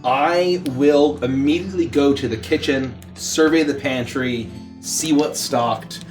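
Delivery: ordinary talk, nothing marked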